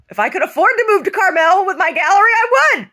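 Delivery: high-pitched, very loud outburst; real exuberant near-shout; loud exclamation. Real, not acted. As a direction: cry it out between bursts of laughter. Exclaiming between bursts of laughter.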